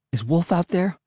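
put-on understated anxious child; anxious question. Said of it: "I asked anxiously".

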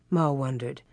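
ordinary delivery, nothing marked

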